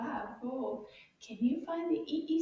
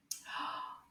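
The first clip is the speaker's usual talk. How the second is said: gasp; 9 dB quieter than usual. Acted. Teacher reveals next picture.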